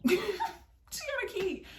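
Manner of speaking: high, drawn-out mock excuse; hammed-up squeaky answer; comic squeal. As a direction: squeak it out slowly, helplessly amused. Repeats reply as comic squeal.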